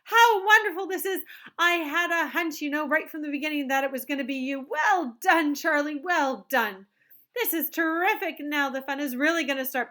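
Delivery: high, loud eager chocolatier voice; excited Wonka. A narrator's reading. Wonka gushing to Charlie about winning. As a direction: bubble over with glee.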